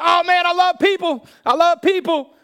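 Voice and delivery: very high, loud gushing; hammed-up overexcited shout; mock enthusiasm. Extrovert asked to share the gospel, gushing loudly.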